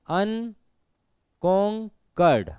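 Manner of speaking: Unconquered very slowly as अन, कॉन, curd; long gaps inside unconquered; three separate syllables. Shown as a demonstration. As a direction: break unconquered into three beats. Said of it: "ये तीन बार में आ रहा है".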